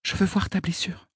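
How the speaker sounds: lightly voiced high young girl. A narrator's reading. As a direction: gently hush him as a girl. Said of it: "me dit Aîné".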